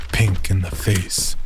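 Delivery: usual speaking voice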